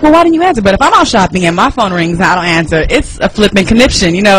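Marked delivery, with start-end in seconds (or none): 0.0-0.6 high, whiny demanding question; mock nagging question. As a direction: nag him in a high voice.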